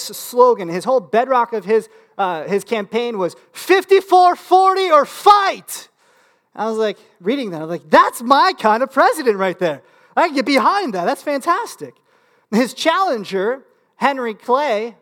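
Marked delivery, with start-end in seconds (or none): 3.5-5.9 loud, high, drawn-out battle cry; rallying shout. Acted. Acting out a campaign slogan. 7.9-11.9 high, fired-up enthusiasm; excited approval. Retold or imitated. Acting his excited reaction to slogan.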